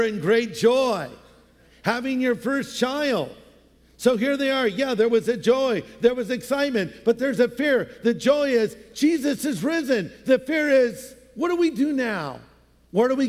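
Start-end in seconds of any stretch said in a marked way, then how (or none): none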